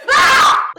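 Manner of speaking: sudden high unvoiced shriek of alarm; startled shriek. Althara shrieks at the rail as someone jumps overboard. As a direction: gasp it out like she just saw him fall. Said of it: "Althara goes".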